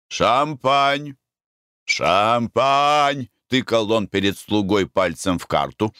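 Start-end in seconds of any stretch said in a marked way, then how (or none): 0.1-3.3 raised, drawn-out word repeated; insistent ordering. A narrator's reading. Diner jabs the wine list at waiter, insistent. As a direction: press the order on a foreign waiter.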